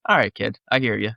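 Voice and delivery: a grown-up humoring his younger self; older, wiser self. Retold or imitated. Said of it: "you feel that, like".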